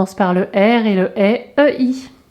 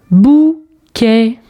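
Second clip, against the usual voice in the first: "bouquet" split into bou, quet with a gap; syllable by syllable. Shown as a demonstration. Teacher correcting the syllable exercise, syllable by syllable. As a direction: sound out "bouquet" in two separate beats. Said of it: "les syllabes où tu entends".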